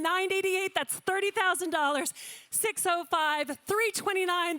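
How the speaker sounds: rapid, rhythmic auction calling of paddle numbers; auctioneer calling. Acted. Auctioneer to gala room, calling paddles in rhythmic auction patter. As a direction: rattle off the bids like a live auctioneer.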